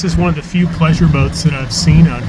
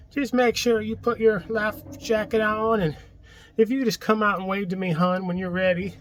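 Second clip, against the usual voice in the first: Mississippi accent imitation; 9 semitones higher, 5 dB quieter than usual. Boater mimics the Mississippi lockmaster's phone call, high Southern accent. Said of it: "really thick Mississippi accent".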